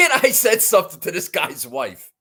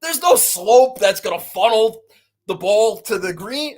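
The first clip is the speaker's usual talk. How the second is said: laughing disbelief; 4 semitones higher than usual. Retold or imitated. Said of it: "I'm like".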